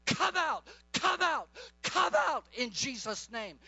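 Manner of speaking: forceful, commanding prayer against pain; healing prayer. Real, not acted. Preacher rebuking someone's back pain in Jesus' name, commanding. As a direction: drive the pain out with firm authority.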